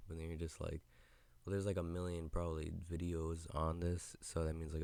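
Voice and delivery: usual speaking voice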